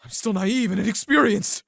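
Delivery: loud, high earnest vow; earnest plea. Acted. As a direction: promise her mother with all your heart. Fiancé pleads for marriage.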